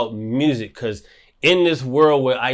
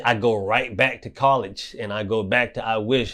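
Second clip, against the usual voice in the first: normal talking voice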